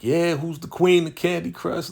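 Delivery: high, gloating cheer of a winner; mock victory squeal, pitched high; celebrating. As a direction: crow it like you just won. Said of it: "she celebrates".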